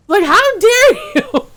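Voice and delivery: high, loud indignant how dare you; mock outrage. Bandit in the skit acting offended, mock outrage. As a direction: play the affronted bandit over the top.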